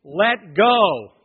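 loud, firm imitated yell; retold yell. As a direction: call it out to a stuck climber. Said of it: "they're yelling at me".

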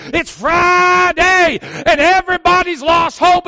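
building shouted preaching. Retold or imitated. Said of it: "he just kept building over and over".

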